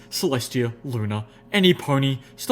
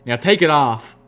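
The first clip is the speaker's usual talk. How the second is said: louder, firm order to take it off; firm command; 3 semitones higher, 7 dB louder than usual. A narrator's reading. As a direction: put your hoof down, demand it. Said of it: "Applejack put her hoof down".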